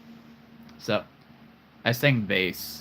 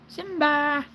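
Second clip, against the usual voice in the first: high call. Pretend. Calling the toy lion by name.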